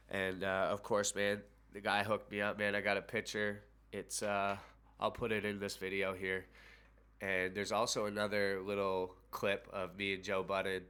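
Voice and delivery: usual speaking voice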